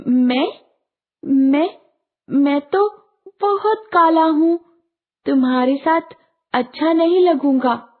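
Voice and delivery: high-pitched, halting, embarrassed little voice; bashful child. A narrator's reading. Shy boy declining the fairy children's invitation, bashful. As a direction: hesitate shyly between phrases, pitched high. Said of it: "झेंप कर कहा".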